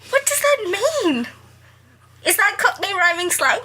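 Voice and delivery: a squeaky, baffled "what does that mean"; baffled disbelief. Real, not acted. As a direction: ask it in total bewilderment. Bewildered reaction to the line.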